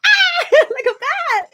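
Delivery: high laughing shriek at a bat; laughing scream. Retold or imitated. Retelling seeing a bat in dream.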